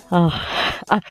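ordinary talk

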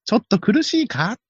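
a hint of concerned race caller; banei race caller impression. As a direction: wonder aloud like a commentator watching a struggling horse. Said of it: "万栄競馬モノマネ".

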